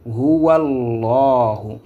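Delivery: "Huwallahu" drawn out as one linked word; liaison. Shown as a demonstration. Teacher explains the link between words, "Huwallahu" slowly joined. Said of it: "qui va permettre de faire la liaison".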